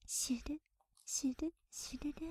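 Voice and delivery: soft breathy swishing sound of a brush; whispered brush swishes. Acted. Maid voicing the brush for her master, whispered swishes. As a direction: hiss the brush strokes softly.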